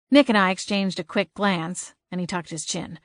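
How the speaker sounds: plain reading voice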